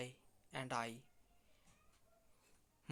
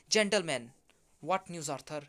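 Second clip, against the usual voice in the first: eager call; 2 semitones higher, 10 dB louder than usual. Acted. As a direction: call out for news as you burst in. Bursting in, calling for news.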